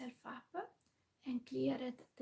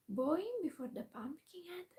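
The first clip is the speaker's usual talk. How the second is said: ordinary delivery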